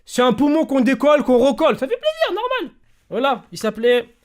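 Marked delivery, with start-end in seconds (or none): 1.8-2.8 mock cheerful irony. Ironic repeat of the same phrase.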